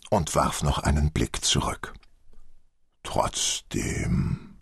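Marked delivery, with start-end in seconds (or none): 3.0-4.6 low, half-voiced plaintive mumble; plaintive murmur. A narrator's reading. Old man looks back from the door, murmuring plaintively. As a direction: murmur it pitifully, drawn out and very low. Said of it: "murmelte er kläglich".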